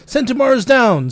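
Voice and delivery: high, loud acted alert; mock-urgent battlefield shout; alarmed report. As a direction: call out the bad news urgently.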